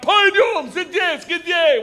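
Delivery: high, loud Russian shout; barked order. Retold or imitated. Cop barks at the student, high loud shout. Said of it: "I hear him bark out".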